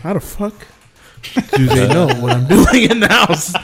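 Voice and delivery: laughing disbelief on discovering cameras; retold shock. Recalling the camera scare, shocked and laughing. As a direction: caught off guard, half laughing. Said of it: "And I was like".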